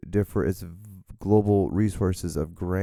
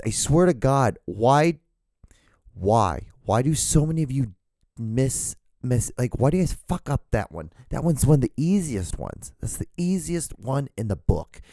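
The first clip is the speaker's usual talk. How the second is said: higher-pitched, incredulous rant; exasperated scolding; 5 semitones higher than usual. Real, not acted. Scolding listeners over a common mistake.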